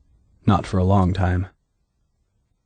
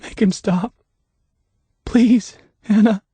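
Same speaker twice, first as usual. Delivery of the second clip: a higher, weak begging; half-hearted plea; 12 semitones higher than usual. A narrator's reading. Buried deputy begging Anna to stop it, half-hearted. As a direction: plead without strength. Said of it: "his words were half-hearted".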